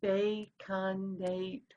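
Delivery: "Baconator" slowly as BAY-CON-AY-TOR; four separate syllables. Shown as a demonstration. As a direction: give "Baconator" four drawn-out, even beats. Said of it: "there's four".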